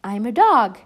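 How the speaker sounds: loud, proud announcement; cried out. Acted. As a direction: proclaim it loudly. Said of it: "cried Missus Beekman".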